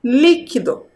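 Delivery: "Líquido" with LÍ said strongest; stress on first syllable. Shown as a demonstration. Teacher reads the word for pupils to find the strong sound, LÍ in "Líquido" stressed. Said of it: "Qual é o som mais forte?".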